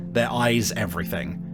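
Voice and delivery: ordinary talk, nothing marked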